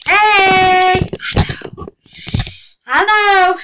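high, loud burst of greeting; excited exclamation. Real, not acted. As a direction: throw it out bright and loud. Reacting to camera, high and loud.

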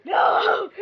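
high, loud outburst; sudden high yell of objection; shouted no. Real, not acted. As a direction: cry out in protest. Cries out to stop friend.

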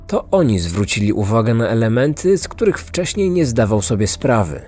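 plain reading voice

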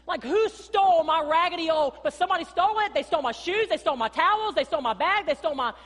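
high, loud comic outrage at the stolen stuff; mock outraged shout. Beachgoer finding their stuff stolen, mock outraged shout. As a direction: play up the shock for laughs. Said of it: "you're like".

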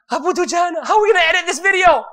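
high, loud, fast panicked cry; he realises the mistake and yells; panicked shout. Retold or imitated. He realises he said the wrong name and retells it as a panicked shout.

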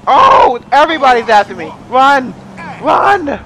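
loud, high-pitched alarm; high, urgent cries to flee; alarmed outcry. Real, not acted. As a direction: yelp as everyone closes in on you. Police arrive, everybody after him.

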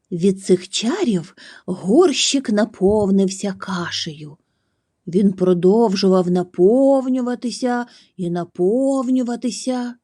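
plain reading voice

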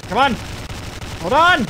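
panicked shout. Real, not acted. Panicked in fight.

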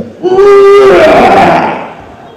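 huge, high, loud mock vomiting roar; full-force imitated retch, loud and drawn out; terrible retching. Shown as a demonstration. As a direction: heave it out long and violently. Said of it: "This terrible retching".

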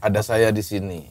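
mock tender promise. Mock continuing the comforting speech.

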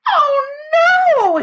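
high, loud "oh no"; horrified scream. A narrator's reading. Sheila seeing the missing teeth, high scream. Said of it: "Sheila screamed".

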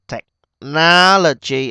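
technology with a huge, long NO; stress on second syllable. Shown as a demonstration. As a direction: stretch tech-NOOO-lo-gy, loud in the middle. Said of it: "âm hai". (nhấn mạnh, kéo dài âm hai technology)

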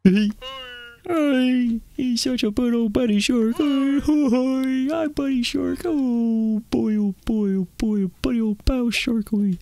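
high cutesy voice to a pet shark; cooing baby talk. Acted. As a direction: coo at the shark like it's a puppy. Gushing over a toy shark.

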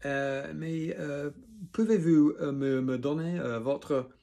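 high, halting French with fillers; absent-minded. A narrator's reading. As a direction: stumble along searching for each word. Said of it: "absent-mindedly".

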